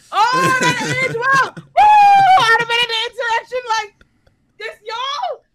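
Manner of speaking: high wheezy laughing shout; cackling hype; wheezing laugh-shout. Real, not acted. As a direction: yell it through helpless laughter, high and strained.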